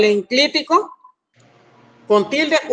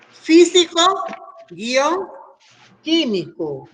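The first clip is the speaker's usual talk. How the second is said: dictating; 5 semitones higher, much slower than usual. Shown as a demonstration. Teacher giving students a word to accent, dictating. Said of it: "pongo a ustedes la palabra".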